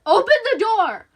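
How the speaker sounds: high, very loud yell; furious demand; shouted command. Acted. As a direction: bellow it through the door. Man shouts through door.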